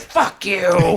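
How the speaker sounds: high, loud furious retort; angry retort. Retold or imitated. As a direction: spit it back at the journalist. Retold angry reply to journalist.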